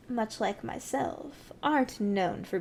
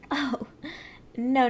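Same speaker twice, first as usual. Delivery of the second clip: usual speaking voice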